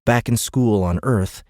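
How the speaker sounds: plain reading voice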